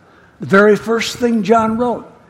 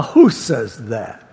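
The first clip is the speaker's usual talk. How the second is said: normal talking voice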